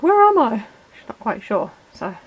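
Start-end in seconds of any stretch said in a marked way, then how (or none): 0.0-0.7 high, loud mock bewilderment for a pet; voiced confused guinea pig. Owner speaks for guinea pig meeting the toy car, mock-confused. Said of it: "she's just kind of like".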